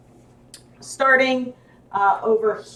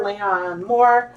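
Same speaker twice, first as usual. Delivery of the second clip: normal talking voice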